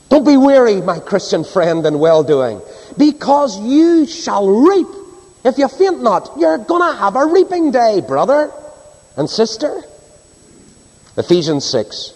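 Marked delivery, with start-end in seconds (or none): 0.1-9.8 high, ringing exhortation that climbs and stretches; fervent revival preacher, pitch soaring; preaching at full force. Acted. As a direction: preach it out to the whole hall.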